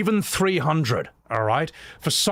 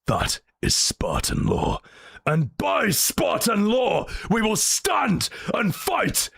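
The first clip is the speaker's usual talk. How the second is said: slow, grave warrior declaration; Spartan war talk; 2 semitones lower, slower than usual. Retold or imitated. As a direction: speak like a Spartan king laying down the law. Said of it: "them having a conversation".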